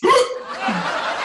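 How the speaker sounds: high hiccup with a laugh; a hiccup breaks through. Acted. Hiccups after tasting remedy.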